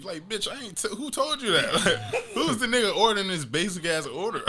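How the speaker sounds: laughing outrage. Retold or imitated. Complaining about the drive-thru guy, laughing outrage. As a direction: rant through laughter.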